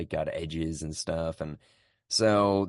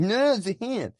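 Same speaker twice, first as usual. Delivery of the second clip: mock earnest protest; 4 dB louder than usual. Stoner answering crowd.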